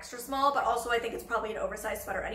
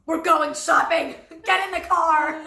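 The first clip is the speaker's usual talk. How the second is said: excited shout; 4 semitones higher, 10 dB louder than usual. Retold or imitated. Friend ordering Mesa to go shopping, loud and excited. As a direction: shout it like a rescue mission. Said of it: "And I was like".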